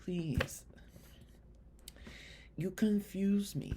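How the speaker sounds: soft, bewildered appeal; hushed pleading. Acted. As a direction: plead quietly with a country as if let down. Acted hurt plea to Italy.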